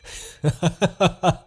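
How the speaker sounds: gleeful evil laugh; villain laugh. Acted. Demon king laughing as his captive takes the cursed sword. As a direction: laugh as the trap closes.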